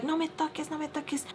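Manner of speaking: soft, high-pitched mock protest; retold touchy protest. Friend told to relax, soft high-pitched mock protest. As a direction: imitate her refusal, high and small.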